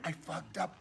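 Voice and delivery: very soft, low confession; defeated mumble. Acted. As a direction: admit it under your breath. Quiet confession in sketch.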